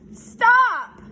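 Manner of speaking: high, loud shriek; scream. A narrator's reading. Pig screams to stop the sheep's story, high and loud. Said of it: "screamed Wilbur".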